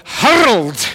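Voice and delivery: put-on HURLED in a loud, drawn-out Scottish accent with a rolling R; booming Scottish "hurled", stretched into two syllables. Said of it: "In his Scottish accent, it would have had two syllables and a rolling R".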